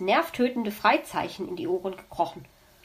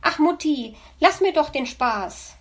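put-on higher, louder pleading little boy; child voice; 5 semitones higher, 4 dB louder than usual. Hedgehog child begs mother, higher-pitched and pleading. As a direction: wheedle like a small child.

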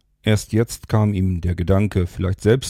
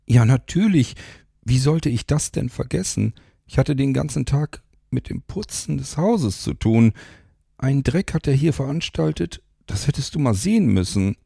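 higher, lightly voiced complaining woman; Mara's exasperated voice; 7 semitones higher than usual. A narrator's reading. Mara telling Rodriguez about the cleaning, higher voice. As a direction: grumble about the mess, pitched up.

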